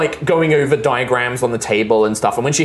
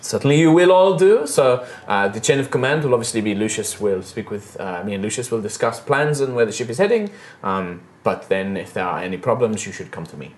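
put-on lightly accented first mate, formal; formal officer speech with a light French touch; French-ish Araya. Araya in-character dialogue.

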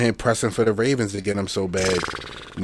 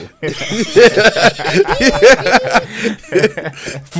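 long, loud, high-pitched laughing fit; real big laugh; 12 semitones higher, 9 dB louder than usual. Real, not acted. Co-host cracking up over the remote story, loud laughter. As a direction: crack up helplessly and loudly.

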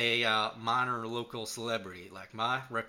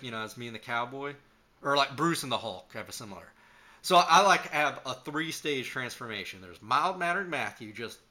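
ordinary talk

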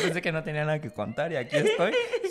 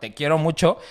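ordinary talk, nothing marked

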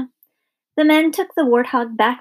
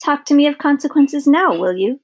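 louder, higher witch's taunt; triumphant taunt; 5 semitones higher, 4 dB louder than usual. A narrator's reading. Witch taunts the princess she has cursed, louder. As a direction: throw the threat back at her.

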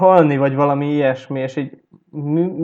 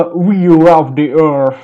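put-on Japanese accent; 6 dB louder, much slower than usual. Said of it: "japán akcentusos angolt".

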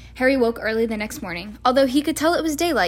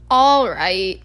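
plain reading voice

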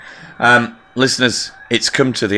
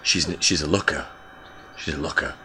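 low leer; 8 semitones lower, 10 dB quieter than usual. Real, not acted. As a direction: lower and softer, appreciative. Low appreciative reply.

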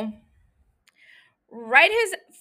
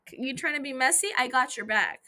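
ordinary talk

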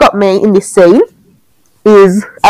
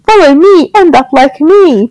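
lightly voiced creepy doll calling; 7 semitones higher than usual. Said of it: "a creepy doll voice starts repeating".

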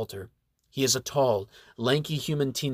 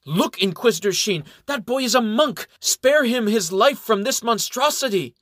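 high, loud, desperate plea; a monk pleading at the top of his voice; crying out; 10 semitones higher, 6 dB louder than usual. A narrator's reading. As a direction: cry out to save the boy. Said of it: "cries out".

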